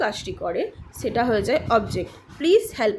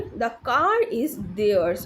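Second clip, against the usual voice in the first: very slow, separated words; deliberate teacher's example reading; repeat-after-me model; much slower than usual. Shown as a demonstration. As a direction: model it slowly for learners to copy. Teacher models sentence for repetition.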